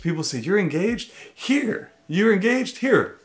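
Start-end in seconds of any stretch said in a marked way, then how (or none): 0.4-1.9 higher, chirpy mock delight, rising question; mock excited stranger. Husband mimics strangers gushing over the newly engaged couple. 2.1-3.2 higher, bouncier mock delight on the second take; mock excited stranger, repeated. Husband repeats the strangers' gushing offer to the engaged couple. Mimics strangers giving free stuff.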